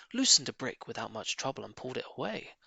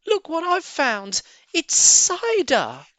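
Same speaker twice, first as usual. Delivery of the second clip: much higher, loud, slow excited shout; thrilled cub; 8 dB louder, slower than usual. A narrator's reading. Small fox shouting his find to Dad. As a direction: shout the discovery with glee. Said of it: "he cried out".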